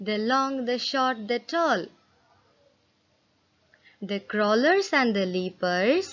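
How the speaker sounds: measured, expressive classroom read-aloud; reading a poem to children. Acted. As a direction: read it like a storybook verse for the class. Reads poem stanza to class.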